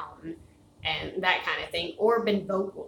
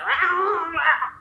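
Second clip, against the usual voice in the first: loud, higher mock dog howl; imitated weird dog noises; 9 semitones higher, 9 dB louder than usual. Owner imitating her dog's weird noises in alert, loud mock howl. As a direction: let out a goofy dog "rah". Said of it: "makes these really weird noises".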